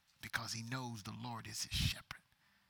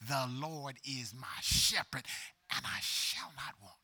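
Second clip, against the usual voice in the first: normal talking voice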